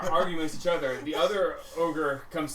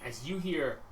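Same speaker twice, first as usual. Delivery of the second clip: usual speaking voice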